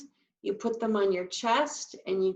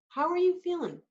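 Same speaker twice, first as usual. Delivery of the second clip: questioning; 8 semitones higher than usual. Shown as a demonstration. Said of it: "question mark look on your face".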